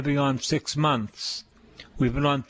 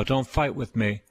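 ordinary talk

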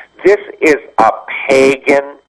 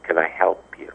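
gentle offer; 5 semitones lower, 9 dB quieter than usual. Retold or imitated. Gently approaching downcast parishioners.

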